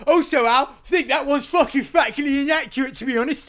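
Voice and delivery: high, loud laughing protest; indignant and giggling; laughing outrage. Real, not acted.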